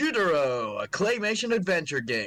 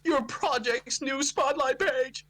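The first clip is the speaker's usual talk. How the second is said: mock excited; 13 semitones higher than usual. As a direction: hype it up like an ad. Reading email in excited voice.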